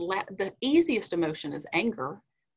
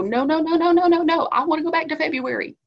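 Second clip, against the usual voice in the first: put-on high, loud childish wail of no, no, no; mock tantrum; 7 semitones higher, 8 dB louder, faster than usual. Speaker mocking a tantrum about lost normal life, loud and high. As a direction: throw a mock toddler tantrum, pitched up and loud. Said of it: "stomp our feet and have a tantrum and go".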